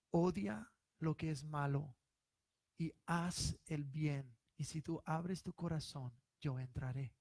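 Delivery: hushed like a divine whisper; solemn voice of God. Retold or imitated. Son retelling the voice he heard, soft and solemn. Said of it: "escuché una voz y me dijo".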